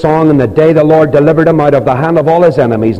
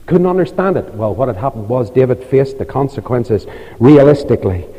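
usual speaking voice

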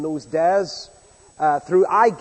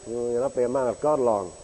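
low sarcastic sneer; 5 semitones lower than usual. Retold or imitated. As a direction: sneer it under your breath.

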